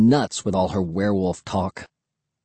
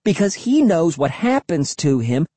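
put-on insistent girl; 9 semitones higher than usual. A narrator's reading. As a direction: press the point, high and urgent. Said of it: "Cassie demanded of Will".

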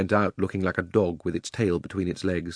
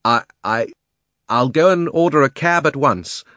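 panicked, higher and louder; gasping; 5 semitones higher, 6 dB louder than usual. A narrator's reading. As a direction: blurt it in fright. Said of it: "gasped Uncle Andrew".

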